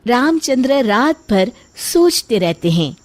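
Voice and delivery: usual speaking voice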